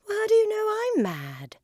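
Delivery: very high, loud incredulous protest; indignant Alice. A narrator's reading. Alice challenges the cat who called her mad, high indignation. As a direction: shoot the pitch right up in indignant disbelief.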